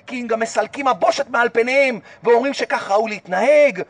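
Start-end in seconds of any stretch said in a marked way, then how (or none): none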